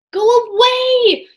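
high, slow shout. A narrator's reading. Jack shouting at the sea serpent to go away. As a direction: yell for the monster to leave. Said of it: "shouted Jack".

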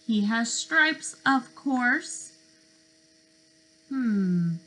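usual speaking voice

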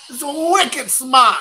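put-on a jokey Boston accent on wicked smart. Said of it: "not even put on Boston accent".

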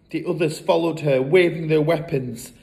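ordinary delivery, nothing marked

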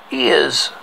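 ordinary delivery, nothing marked

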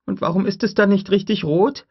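put-on a curious little girl asking; young girl voice. Lex asks Grant about the raptor, high child voice.

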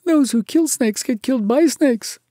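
high, loud woeful lament; very sorrowful. A narrator's reading. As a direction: wail it as a doomed little creature. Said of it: "more sorrowfully than ever".

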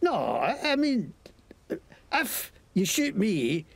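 lightly Scottish mocking thug; Scottish accent. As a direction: sneer at them in Scots. Scottish NPC bargains.

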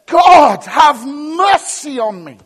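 very loud, high shout to God; crying out. Acted. Preacher acts David crying out to God, a loud shout. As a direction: bellow a desperate plea, words drawn out. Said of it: "he's crying out to God".

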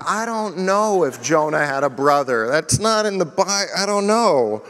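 lightly voiced confused reply; mock puzzled reply. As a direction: play someone stumped by a Bible question. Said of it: "I'm like".

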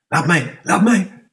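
steeds hoger en harder roepen; roepend. Acted. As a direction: opdringerig laat mij roepen. Gerard eist de bal op. (Gerard roept om de bal, hoog en luid)

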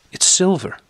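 put-on a frightened boy offering the watch; nervous. A narrator's reading. Boy nervously insisting to the bully, high and anxious. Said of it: "I repeated nervously".

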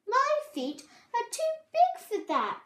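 high, loud sulky protest; indignant complaint. A narrator's reading. Babbitty can't do the trick, indignant whine. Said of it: "Babbitty thought".

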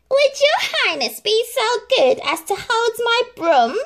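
very high, loud, mocking voice; bow in mock courtesy, dripping scorn; mocking stepsister. A narrator's reading. Stepsister taunts Cinderella, very high mocking voice.

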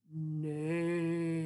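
drawn-out horse neigh; a proud unicorn neigh; mighty neigh. A narrator's reading. The unicorn neighs a mighty neigh. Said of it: "He neighs a mighty".

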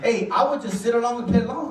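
usual speaking voice

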